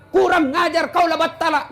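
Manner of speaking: high-pitched mock scolding; hammed-up marital insult, pitched well up; acted angry husband. Husband curses at his wife in quarrel, mock anger. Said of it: "Kalau dia marah, ibu juga marah".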